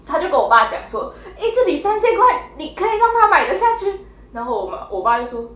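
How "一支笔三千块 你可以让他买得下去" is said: high, loud mock scolding of the dad; incredulous telling-off, hammed up; mock outraged mom. Imitating mom scolding dad for letting the child buy it, high and loud.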